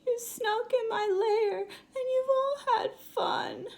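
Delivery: high, wailing sob of a hurt bear; whimpering bear. Acted. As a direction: cry it out, feeling left out. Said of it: "He whimpers and he moans and he wails".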